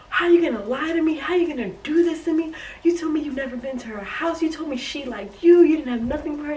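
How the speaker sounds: angry confrontation with the ex; yelling at him. Retold or imitated. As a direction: let him have it, voice up high. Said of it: "I start spazzing".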